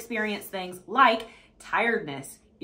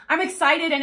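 higher, louder burst of enthusiasm; excited affirmation; 6 semitones higher, 5 dB louder than usual. Shown as a demonstration. Coach modelling an affirmation for listeners, excited. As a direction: declare it with excitement.

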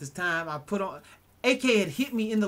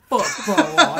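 higher, louder, laughing through it; mock heel taunt, laughing; 6 semitones higher, 7 dB louder, slower than usual. Heel wrestler cutting a promo at the camera, loud and laughing. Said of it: "He goes".

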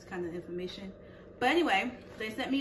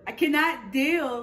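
exasperated; 5 semitones higher, 11 dB louder than usual. Real, not acted. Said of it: "I'm stressed out".

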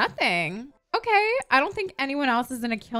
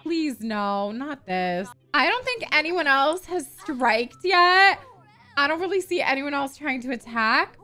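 usual speaking voice

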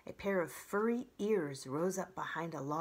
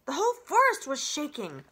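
high, loud, urgent fox voice; excited fox; 9 semitones higher, 10 dB louder than usual. Acted. Fox telling the robot about the quake, high and loud. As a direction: blurt it like a startled fox.

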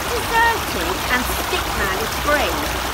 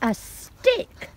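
dog's voice; 6 semitones higher, 4 dB quieter than usual. Acted. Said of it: "barks the dog".

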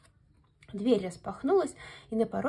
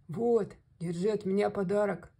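bear's growl. A narrator's reading. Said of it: "прорычал медведь".

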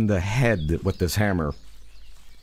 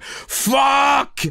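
mock yell; 14 semitones higher, 3 dB louder than usual. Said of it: "I coulda gone like".

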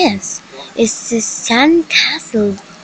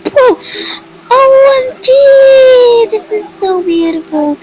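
much higher, loud thrilled squeal; real excited high cries; 13 semitones higher, 3 dB louder than usual. Real, not acted. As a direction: squeal with delight. Thrilled on the ride.

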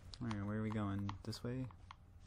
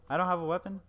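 surprised; 7 semitones higher, 5 dB louder, faster than usual. Real, not acted. Surprised, no weapon.

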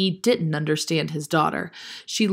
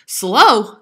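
loud, higher exclamation; indignant cry; 4 semitones higher, 9 dB louder than usual. A narrator's reading. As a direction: cry it out in disbelief. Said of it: "cried Grandfather".

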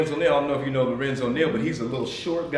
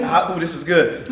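ordinary talk

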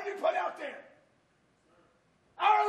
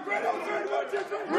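team break call. Real, not acted. Coach calls team break.

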